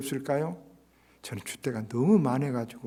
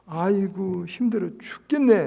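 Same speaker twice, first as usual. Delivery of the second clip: mock whiny grumble, higher; grumbling complaint; 5 semitones higher, 5 dB louder, slower than usual. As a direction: moan like someone fed up. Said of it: "성질을 내면".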